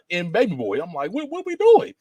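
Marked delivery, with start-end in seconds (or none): 1.1-1.9 mock-incredulous, pitched way up; baffled reaction. Viewer questioning an actor's sudden gangster turn, mock-incredulous. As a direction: act out high-voiced, louder disbelief.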